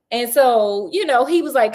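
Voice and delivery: ordinary delivery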